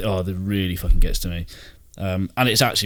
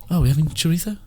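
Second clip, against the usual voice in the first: higher, put-on voice, chorizo lisped as cho-ree-tho; posh mock; 8 semitones higher, 3 dB louder than usual. Mocking friends who say chorizo, higher and lisped. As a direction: parody a pretentious diner. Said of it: "they'll be like".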